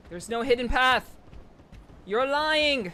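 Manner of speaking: yelling in outrage at the screen; loud, furious disbelief; angry shout. Real, not acted. Yelling at a fake game hint.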